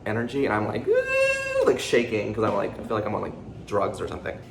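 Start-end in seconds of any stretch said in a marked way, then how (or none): 0.8-1.7 very high-pitched held "ooh"; jittery squeal. Acted. Showing viewers the pre-workout shakes, jittery high squeal. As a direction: squeal like you are buzzing on caffeine.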